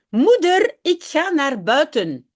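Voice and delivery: put-on child calling to mother. Jip calls to his mother that he's going out, eager child's call. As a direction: call out to mum like a little boy heading outside.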